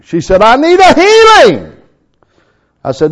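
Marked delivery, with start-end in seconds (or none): 0.4-1.7 high, loud cry of need; mock desperate shout, high and loud; pleading woman. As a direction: plead loudly like a desperate caller. Woman on phone demands healing.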